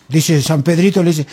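normal talking voice

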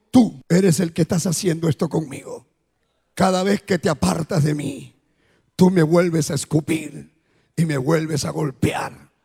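Jesus speaking. Retold or imitated. Jesus reproaching the dreamer, low and solemn. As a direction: speak low and heavy, wounded. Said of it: "Y le dijo".

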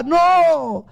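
a high, mock wailing cry of no; acted cry. Jacob crying out to the angel, high mock wail. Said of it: "Y gritaba".

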